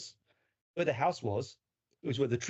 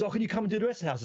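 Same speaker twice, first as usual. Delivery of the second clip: fast, higher, laughing through the question; laughing while quoting; 8 semitones higher, much faster than usual. Retold or imitated. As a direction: retell her request while cracking up. Woman asking him back, laughing.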